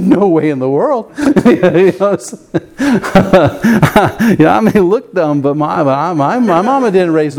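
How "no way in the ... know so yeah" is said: laughing. Real, not acted. Refusing the whale, laughing.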